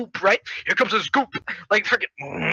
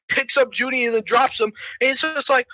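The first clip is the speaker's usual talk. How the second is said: ordinary talk, nothing marked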